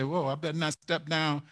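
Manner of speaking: soft, quick muttering to oneself after a fall; hushed fast inner voice; cautious self-talk. Retold or imitated. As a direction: warn yourself under your breath, hurried. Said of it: "you just say".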